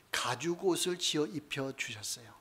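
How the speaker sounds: 가죽 옷을 지어 입혀 주셨어요 whispered, voiceless and hushed. Real, not acted. Preacher whispers how God clothed Adam in leather, hushed.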